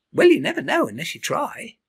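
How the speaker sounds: high, bright cheerful bird voice; a friendly bird cheering him on; bird Iris. Acted.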